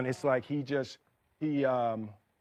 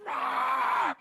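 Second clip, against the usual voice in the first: high, drawn-out scream of frustration; frustrated scream; 11 semitones higher than usual. Retold or imitated. Actor retelling his flubbed take, frustrated scream. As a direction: scream like your mind just snapped. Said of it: "my mind snapped".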